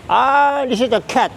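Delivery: ordinary delivery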